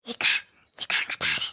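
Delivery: hushed whisper, no voice. Acted. Maid secretly points the sister-in-law out, whispering. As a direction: whisper so she won't hear.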